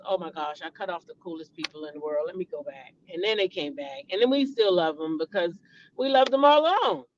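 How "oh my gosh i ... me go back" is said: soft, sheepish inner voice; a hushed mock realization; imitating the kids. Family kids realizing their mistake, softly imitated. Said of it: "And then they realize".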